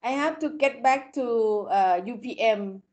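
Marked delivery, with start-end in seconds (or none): none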